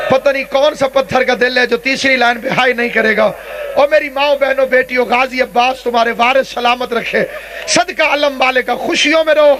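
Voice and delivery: ordinary delivery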